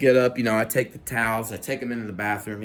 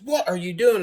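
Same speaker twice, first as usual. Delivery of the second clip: high startled woman's voice; imitating her alarm; 12 semitones higher, 3 dB louder than usual. Natalie waking to find him peeing, high startled voice. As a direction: jump way up in pitch like her. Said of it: "She goes".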